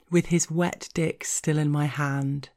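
ordinary delivery

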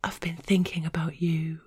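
breathy whispered line. A narrator's reading. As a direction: whisper it close and soft. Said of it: "Her voice was a whisper".

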